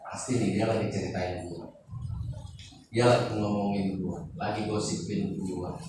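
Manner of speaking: low, hushed self-talk; suspicious inner grumble. Acted. Preacher voices someone overhearing whispers, suspicious and hushed. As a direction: drop low and soft, as if privately suspecting gossip. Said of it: "Banyak orang kan gini".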